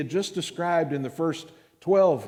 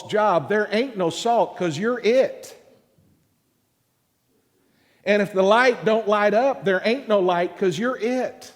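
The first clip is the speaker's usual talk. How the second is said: louder and higher, driving home the point; full-force preaching; 7 semitones higher, 7 dB louder than usual. Acted. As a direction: preach it to the rafters. Preaching climax.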